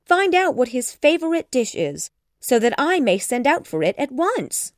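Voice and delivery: high, loud, fussing request; flustered excitement at full pitch; eager mother. A narrator's reading. Mother urges daughter to invite him, excited high voice.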